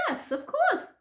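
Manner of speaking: very high-pitched imitation of mom; put-on high, unbothered mother; mother's voice. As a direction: brush off the worry in a high voice. Voices mother's impatient answer.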